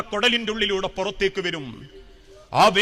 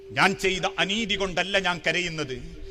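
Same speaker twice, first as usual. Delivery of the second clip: low, soft, understated grief; heartbroken reply; 5 semitones lower, 6 dB quieter than usual. Retold or imitated. Umar answering his worried companions, understated heartbreak. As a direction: retell a weeping man's answer with subdued sorrow. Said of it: "നെഞ്ചു പൊട്ടി തിരിച്ചു പറഞ്ഞു".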